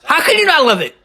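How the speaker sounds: high-pitched, thrilled exclamation; hyped-up, high-pitched appeal; excited enthusiasm. Real, not acted. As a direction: burst out with real delight. Thrilled reaction to wrestling clip.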